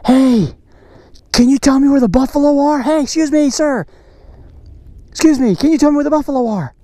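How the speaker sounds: high, quick hail; playful calling out. Pretend. Vlogger asking an animal where the buffalo are, calling out. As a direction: call over to get someone's attention.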